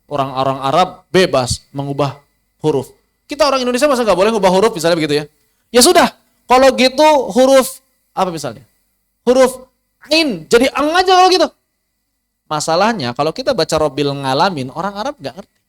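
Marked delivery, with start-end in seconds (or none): 3.3-11.5 quick, higher, louder naive arguer; mock objector. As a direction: rattle off the silly objection. Mocking objector voice. (suara mengejek, cepat dan tinggi)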